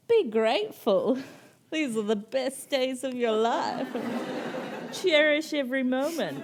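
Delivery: high, loud mock-sweet aunt voice; preachy aunt. Mocking her aunt's advice to a tired mum, high gushing voice. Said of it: "And she's like".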